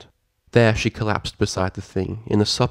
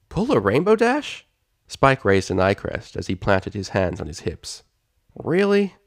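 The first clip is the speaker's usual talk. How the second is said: Spike voice. Acted. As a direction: voice a cheeky young dragon.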